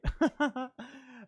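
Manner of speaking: short real chuckle; quick amused laugh. Real, not acted. Teacher amused at promising happy stuff, laughing.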